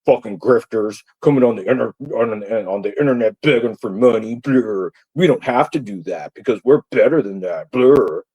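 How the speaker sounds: jeering imitation of sneering critics; mock their superior grumbling, ending in "blur"; mocking detractors. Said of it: "you guys are just, like".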